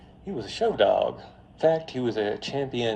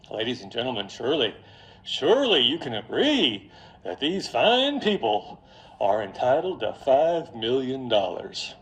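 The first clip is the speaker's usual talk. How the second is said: put-on Mississippi accent; 2 semitones higher, 3 dB louder than usual. Lawyer asking jury for five million, Mississippi accent. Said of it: "it's my Mississippi accent".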